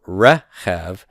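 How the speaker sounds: "rechev" slowly, weight on the first syllable; non-final stress. Shown as a demonstration. Teacher explaining the accent mark, first syllable stressed in "rechev". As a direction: stretch and stress the start of "rechev". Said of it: "non-final syllable being stressed".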